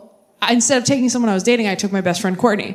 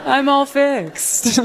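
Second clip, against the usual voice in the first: smug, slow; 4 semitones higher, much slower than usual. Retold or imitated. Smug quote, drawn out slow.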